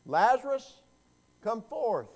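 high, loud commanding call of "Lazarus, come forth"; ringing command shouted into the grave; loud cry. A narrator's reading. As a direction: call the dead man out of the tomb. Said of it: "Jesus cried out with a loud voice".

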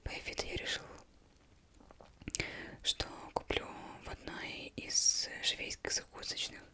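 plain reading voice

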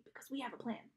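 shaky, fading, nervous; trembling. A narrator's reading. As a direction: try to sound brave but scared. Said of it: "trembling next to me".

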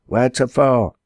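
a high hailing call down the road. Acted. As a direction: call out to a passing traveller. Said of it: "cried he".

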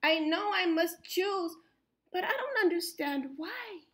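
ordinary delivery

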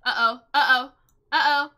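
ordinary talk

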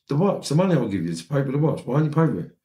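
high, fast exasperated demand; urgent, indignant retelling; acted indignation. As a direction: re-enact arguing your case, pitched well up. Acted quarrel over the watch.

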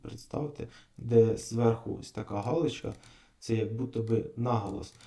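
ordinary delivery

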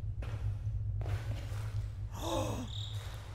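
breathy startled gasp; sharp gasp. Real, not acted. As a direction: gasp in surprise. Game suddenly changed.

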